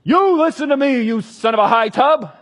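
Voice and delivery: high, loud, furious yell; raging shout. Acted. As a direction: scream it at the priest. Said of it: "Saul shouted at them".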